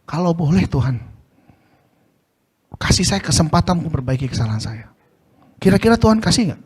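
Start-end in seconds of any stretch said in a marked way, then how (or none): 0.0-4.9 much softer, lower, humble asking; humble request. Shown as a demonstration. Preacher demonstrating the right, humble prayer to God, soft and meek. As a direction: ask gently, as if you dare not insist. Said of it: "Jangan make perintah, "Kalau boleh".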